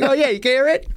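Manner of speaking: high, mocking squawk of a New York pigeon. Co-host mocking her voice as a New York pigeon, high and whiny. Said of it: "You have the voice of a New York pigeon".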